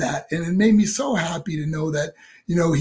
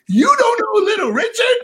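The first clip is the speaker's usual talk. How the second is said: much higher, mock disbelief; incredulous Dusty Rhodes; 4 dB louder than usual. Dusty Rhodes teasing the young wrestler, in a high incredulous voice. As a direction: play the mentor amazed at the kid. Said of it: "He goes".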